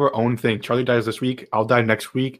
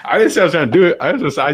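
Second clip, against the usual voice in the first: excited protest; 6 semitones higher than usual. Real, not acted. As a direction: argue it hard, voice up high. Heated argument over hot sauce.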